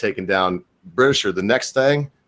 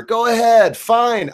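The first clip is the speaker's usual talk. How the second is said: much higher and louder, a defiant yell; exasperated outburst; 12 semitones higher, 4 dB louder, slower than usual. Real, not acted. Streamer daring his online critics, in a loud defiant outburst. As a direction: throw it back at them, fed up. Challenging attackers, rising exasperation.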